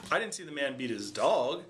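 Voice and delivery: "dog" held and hit hard at the end; stress on DOG. Shown as a demonstration. Explaining he beat something else, stress on "dog". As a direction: save the punch for the final word "dog". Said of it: "stressing and emphasizing a different word".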